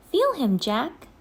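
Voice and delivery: put-on high, eager coaxing girl; pleading little sister, high; Annie urging. A narrator's reading. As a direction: coax him, bright and high.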